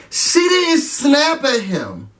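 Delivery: slow, deliberate protest; drawn-out indignant denial. Real, not acted. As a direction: spell it out slowly in disbelief. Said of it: "indignant emphasis".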